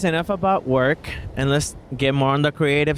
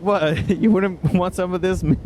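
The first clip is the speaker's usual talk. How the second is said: laughing; 5 semitones higher than usual. Retold or imitated. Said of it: "And I was like".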